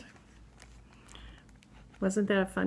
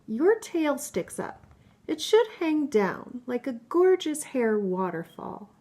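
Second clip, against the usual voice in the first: lively, higher voice for each storybook animal; playful book characters; 3 semitones higher, 4 dB louder than usual. Acted. Mother reading a picture book to her child, lively character voices. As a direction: read the animal friends' squabble with bounce.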